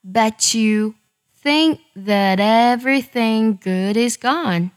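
slow careful read, linking "But you"; read slowly. Shown as a demonstration. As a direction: read it slowly, joining sounds. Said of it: "听我来慢慢读一下".

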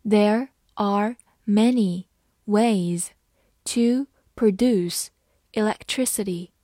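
There are many ways read slowly in chunks. Shown as a demonstration. Teacher slowly reading the sentence to students, phrase by phrase. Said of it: "好，慢读一遍".

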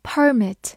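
"permit" stressed up front, PER-mit; stress on first syllable. Shown as a demonstration. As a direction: say "permit" as the noun, strong PER. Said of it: "它的重音放在了前面". ("permit"重音放在第一音节)

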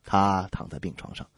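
ordinary talk, nothing marked

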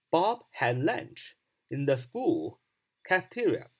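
very slow, deliberate English sentence; slow model reading. Shown as a demonstration. Teacher repeats the sentence slowly to drill it. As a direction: give each word room, slowly. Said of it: "那你要这样说".

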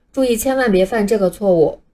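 usual speaking voice

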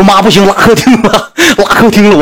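urgent high-pitched warning to mom; retold panic. Son warning mom he soiled the living room, panicked. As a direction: replay a flustered cry for help.

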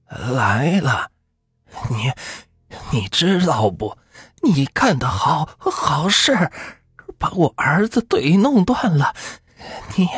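put-on high, hoarse whisper of a possessed child. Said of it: "沙哑地说道".

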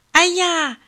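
a sudden high 哎呀 of alarm; surprised cry. A narrator's reading. Old man waking to find his hats missing, alarmed. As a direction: gasp it out as the hats are gone.

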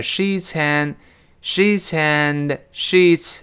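sheets and run together slowly as sheetsand; 慢慢连读 sheets and; linking demo. Shown as a demonstration. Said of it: "Sheets和and连在一块儿". (sheets and 连读示范)

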